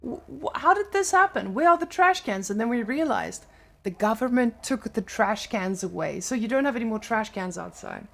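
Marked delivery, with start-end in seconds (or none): 0.0-2.5 higher-pitched mock-baffled outcry; baffled retold question, voice jumping high; retold bewilderment. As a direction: quote your own puzzled reaction, pitched up. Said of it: "And we were like".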